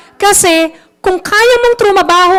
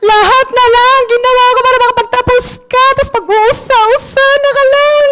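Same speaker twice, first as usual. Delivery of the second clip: angry mother voice; 10 semitones higher than usual. Retold or imitated. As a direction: rant like a mother who sacrificed everything. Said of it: "Ganyan, di ba?".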